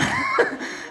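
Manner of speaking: imitated high-pitched cackling burst; hysterical cackle. Retelling her laugh on national TV, high hysterical cackle. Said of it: "this hysterical cackling".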